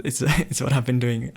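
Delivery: ordinary talk, nothing marked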